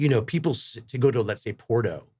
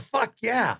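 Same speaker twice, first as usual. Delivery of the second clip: higher, pumped-up cheer; mock excited; 12 semitones higher than usual. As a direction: cheer like a fan. Retells his eager reaction.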